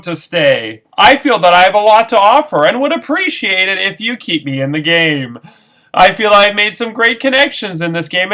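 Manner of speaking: lightly voiced politician speech; a touch of stump-speech poise; Hillary Clinton voice. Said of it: "I finally mastered Hillary Clinton's voice".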